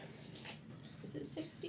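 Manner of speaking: whisper. Real, not acted. Whispered aside.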